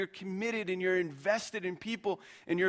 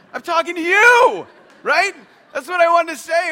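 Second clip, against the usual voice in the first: much higher, much louder outburst; frustrated shout; 12 semitones higher, 15 dB louder than usual. Retold or imitated. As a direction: blurt it out at full volume, fed up. Pastor blurts out his real frustration.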